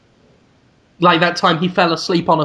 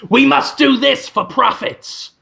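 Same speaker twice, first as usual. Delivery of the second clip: slow, higher-pitched proclamation; Willem Dafoe; 6 semitones higher, 3 dB louder, slower than usual. Acted. Boss addressing a profits meeting, slow proclaiming voice. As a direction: proclaim it to the whole room. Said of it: "and he's like".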